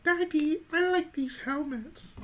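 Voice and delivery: put-on high, slow little child voice; squeaky toddler pleading with daddy; little kid voice. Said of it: "they're like".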